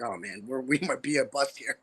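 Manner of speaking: low, soft, deflated aside; retold dejection. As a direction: sink into quiet worry, imitating your earlier self. Worried nobody is coming to show.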